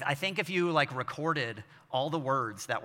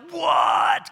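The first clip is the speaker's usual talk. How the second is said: high, loud, fed-up What; exasperated shout; 12 dB louder than usual. Retold or imitated. Dad reaching the kids, an exasperated high shout. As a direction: snap it out after too many interruptions.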